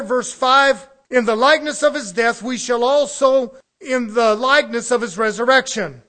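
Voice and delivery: loud, driving preacher's cadence; full-force preaching. Acted. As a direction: hammer the point home to the congregation.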